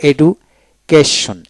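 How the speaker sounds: slow এডুকেশন broken into parts; syllable by syllable. Shown as a demonstration. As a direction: pause inside এডুকেশন between এডু and কেশন. Word read out split into syllables.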